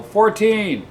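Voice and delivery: high-pitched call-out across a room; acted yell. Inmate calling out a joke number to the cellblock, yelled. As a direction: yell it out for everyone to hear. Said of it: "He hears somebody yell".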